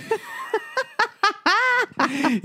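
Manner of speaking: a shrill burst of laughter up high; high squealing laugh. Real, not acted. Laughing at the sweet poop smell he described, high squealing laugh. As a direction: crack up at your own gross joke. Laughing at own gross joke.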